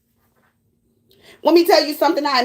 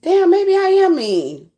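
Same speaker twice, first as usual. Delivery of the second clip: dawning realization; 5 semitones higher, slower than usual. Retold or imitated. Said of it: "And I like".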